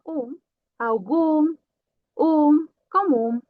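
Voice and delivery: um, algum and comum ending in a nasal um. Shown as a demonstration. Teacher modeling the nasal final um for students. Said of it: "a pronúncia só vai ser".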